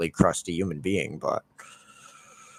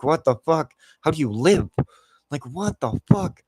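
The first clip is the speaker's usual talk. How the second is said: higher-pitched retold disbelief; incredulous; 5 semitones higher, 3 dB louder than usual. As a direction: react in disbelief, pitch lifted. Reacting to friend's minimum wage.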